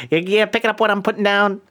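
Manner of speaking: high, thin cartoon villain; lightly voiced Skeletor. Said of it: "less gangster and more Skeletor".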